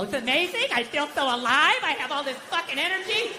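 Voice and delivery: sarcastically chirpy imitation; mock happy sober person. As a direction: gush like an annoying convert. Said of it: "hate these happy, sober people".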